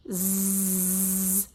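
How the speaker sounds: "zzz" as a long, steady buzz; continuous voiced buzz on "zzz"; stretched-out z. Shown as a demonstration. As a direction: hold "zzz" evenly with no vowel. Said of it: "This sound says".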